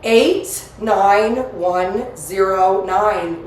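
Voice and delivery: very slow, each digit separate; dictating. Real, not acted. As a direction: spell out every number slowly. Repeating zip code again.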